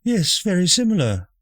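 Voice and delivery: high, prim mother voice; posh mother voice. Acted. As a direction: play the prim, nosy mother.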